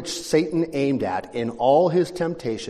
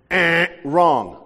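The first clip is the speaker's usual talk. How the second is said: louder mock buzzer "Eh, wrong"; buzzer-like rejection; 3 semitones higher, 7 dB louder than usual. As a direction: slap it down like a quiz show buzzer. Bible voiced as game-show buzzer.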